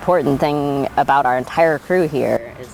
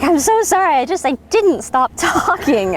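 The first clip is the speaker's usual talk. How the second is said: apologetic mom, laughing; 12 semitones higher than usual. Retold or imitated.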